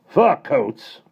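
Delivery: high, loud outraged roar. A narrator's reading. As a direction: roar in disbelief. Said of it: "roared the Admiral".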